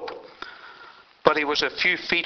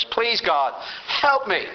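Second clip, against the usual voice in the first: high desperate cry for help, rising hard; pleading scream; 6 semitones higher, slower than usual. Retold or imitated. Falling climber screams to God for help, high desperate cry. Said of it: "scream out at the top of his voice".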